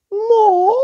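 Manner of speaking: high shrieked command; shrieking demand. A narrator's reading. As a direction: screech it out greedily. Sisters demanding more ripping.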